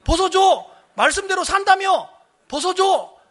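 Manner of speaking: high, loud teasing demands; gleeful egging on. Retold or imitated. Excited pastors goading their flustered colleague, high and punchy. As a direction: imitate an excited crowd calling out. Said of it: "옆에 있는 목사님들이 신나가지고".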